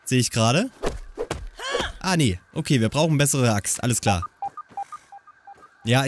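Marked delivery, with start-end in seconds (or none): none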